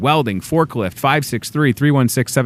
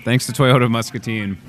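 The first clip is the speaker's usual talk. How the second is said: usual speaking voice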